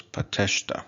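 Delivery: "pateshta" stressed on tesh; second-to-last stress. Shown as a demonstration. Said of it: "the stress is gonna fall".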